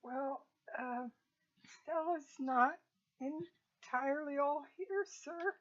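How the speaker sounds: very soft, halting, tentative; hushed servant voice, picking words slowly; delicate, timid cook. Acted. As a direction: put it gingerly and quietly to a superior. Cook delicately explains Stella's condition.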